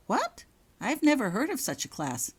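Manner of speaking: high jump on what; baffled disbelief; startled. Acted. Girl hears of an unknown class, startled.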